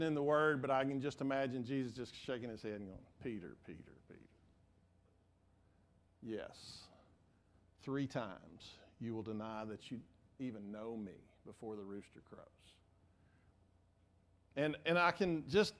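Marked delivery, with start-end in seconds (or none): 3.2-4.2 soft, low, pleading repetition of a name; gentle calling. Acted. Jesus softly calling to Peter, gentle. 6.2-12.5 low, soft, knowing; soft Jesus voice. Acted. Jesus tells Peter he will deny him, softly.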